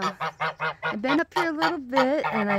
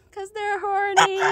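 laughing shout; 8 semitones higher than usual. Real, not acted. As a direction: burst out with the joke, laughing. Punchline with laugh.

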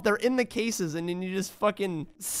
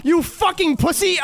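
much higher, louder yelled insult; angry shout; 9 semitones higher, 5 dB louder than usual. Real, not acted. Gamer ambushed by the enemy he mocked, shouting. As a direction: scream "you fucking pussy!" in panic. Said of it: "I ate my words so fast".

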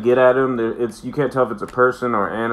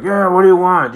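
put-on higher, louder drunken grumble; a hungover man annoyed at the door; drunk grumpy Picard; 8 semitones higher, 7 dB louder than usual. Drunk Picard answering Wesley at dawn, irritated. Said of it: "He's just fucking all drunk".

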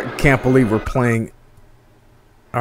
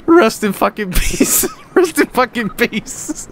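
high, wheezing words through laughter; helpless laughing; slower than usual. Real, not acted. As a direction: laugh so hard the words squeal out. Cracking up at the wrecked park.

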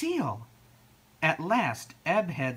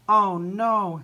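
loud, raised cry of dismay; 6 semitones higher, 8 dB louder than usual. A narrator's reading. Flo seeing seals everywhere, dismayed. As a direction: wail it in storybook dismay.

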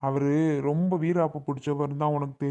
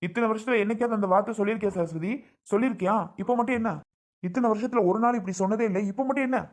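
higher and higher, hurt and accusing; angry reproach; 6 semitones higher than usual. Acted. Husband reproaching his wife Saraswathi, voice climbing. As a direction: throw the question back, rising.

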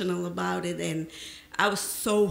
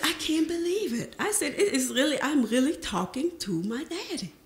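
higher, lightly breathless with amazement; overjoyed; 7 semitones higher than usual. Real, not acted. Telling her father she can't believe it, overjoyed. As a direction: still buzzing from the laugh, amazed. Talking to father first time.

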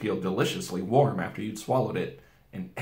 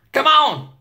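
high, much louder eager shout; crying out; 10 dB louder than usual. A narrator's reading. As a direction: urge them on with a shout. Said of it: "cried Mister Beaver".

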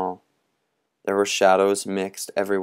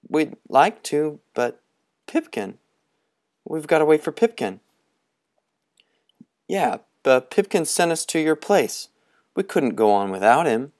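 put-on higher, boyish pleading; young boys explaining quickly; eager boys; 7 semitones higher than usual. Boys answer Moundshroud.

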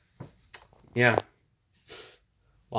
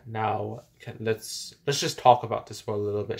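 ordinary delivery

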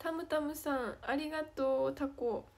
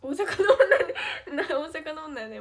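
bursting into loud laughter mid-line; laughing; 3 semitones higher, 13 dB louder than usual. Real, not acted. Joking to viewers she's an Osaka girl, laughing out loud. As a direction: crack up while announcing yourself.

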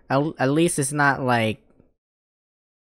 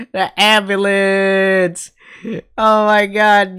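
slow, stretched and higher; drawn-out disbelief; 6 semitones higher, 5 dB louder, much slower than usual. Real, not acted. Streamer marvelling at the brutal knockout, drawn out. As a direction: stretch the words out in shock.

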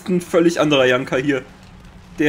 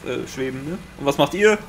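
normal talking voice

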